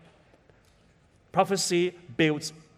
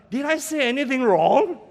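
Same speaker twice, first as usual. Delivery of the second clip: higher, louder worried question; alarmed question; 6 semitones higher, 6 dB louder than usual. Retold or imitated. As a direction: blurt it out, suddenly alarmed you upset her. Pastor worried after girl cries.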